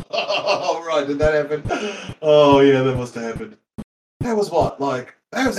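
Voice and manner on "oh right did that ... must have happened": chuckling through the words; amused disbelief with a laugh. Real, not acted. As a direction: laugh while asking it. Laughing at father's claim.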